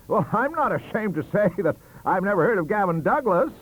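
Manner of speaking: defiant. Acted. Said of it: "said he".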